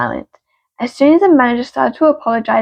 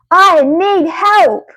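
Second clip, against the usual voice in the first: high, drawn-out yell for help; acted yell; 6 semitones higher, 3 dB louder, much slower than usual. Worker yelling at the assistant manager for help, drawn-out acted yell. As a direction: call out slowly and high, pleading for help. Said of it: "I yell at her".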